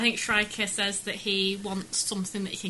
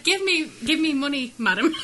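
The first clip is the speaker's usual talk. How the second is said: politely put-on demand, breaking into amusement; mock-polite mugger; 4 semitones higher, 3 dB louder than usual. Co-host imagines a toned-down mugger hassling a woman, mock-polite. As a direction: make the robbery demand comically courteous. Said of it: "It's very polite".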